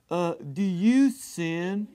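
slow, drawn-out impression of a radio host; stretched-out mocking imitation of a talk host; Jesse Lee Peterson. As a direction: mimic the interviewer, dragging each word out. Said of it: "this is how he talks".

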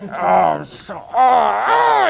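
gritted teeth, strained; talking through teeth. Shown as a demonstration. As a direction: speak with teeth clenched shut. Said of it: "you start talking through your teeth like this".

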